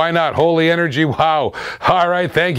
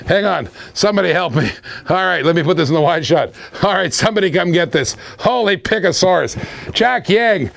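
usual speaking voice

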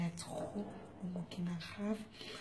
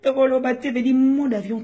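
high, loud hype shout; excited shout; 6 semitones higher, 15 dB louder than usual. Acted. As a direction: yell it to the whole crowd. Shouting shout-outs to fans. (crié très fort, voix aiguë)

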